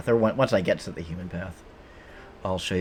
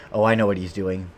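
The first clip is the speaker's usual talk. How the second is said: ordinary talk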